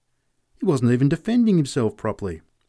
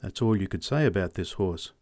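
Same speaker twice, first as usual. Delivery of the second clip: usual speaking voice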